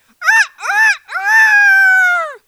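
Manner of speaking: very high shouted crow; rooster crowing. A narrator's reading. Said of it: "he cried out".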